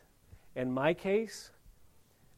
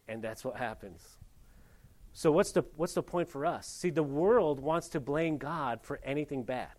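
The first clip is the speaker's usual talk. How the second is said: normal talking voice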